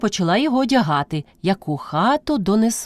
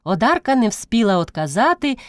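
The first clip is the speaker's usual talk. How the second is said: ordinary delivery, nothing marked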